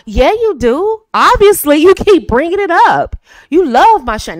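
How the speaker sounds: playful teasing. Acted. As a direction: tease him loudly, sure he's hooked. Acting out teasing comeback.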